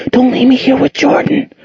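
high, panicked pleading; frantic. A narrator's reading. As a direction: beg him not to go, terrified. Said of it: "Angel whispered frantically".

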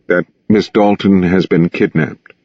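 usual speaking voice

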